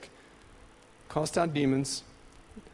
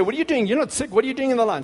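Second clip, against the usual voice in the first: a higher, pointed challenge; pastor challenging; 7 semitones higher, much faster than usual. Retold or imitated. Pastor questioning a healthy man in the prayer line, higher and pointed. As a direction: demand it of the young man in line. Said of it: "the pastor would say to me".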